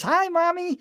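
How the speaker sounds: put-on high child's greeting, pitched well up; a lightly voiced little girl. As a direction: play the small daughter greeting her mother. Child's voice in retold story.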